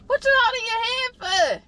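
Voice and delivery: ordinary delivery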